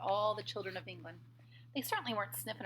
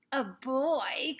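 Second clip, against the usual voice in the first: higher, louder outraged question; shocked witches; 5 semitones higher, 6 dB louder than usual. A narrator's reading. Witches hearing about a boy, shocked. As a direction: recoil at the word. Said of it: "cried the witches".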